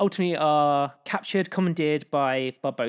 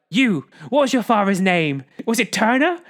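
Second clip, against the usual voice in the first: mock angry demand; 5 semitones higher, 6 dB louder than usual. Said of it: "Barbossa turns to her and is like".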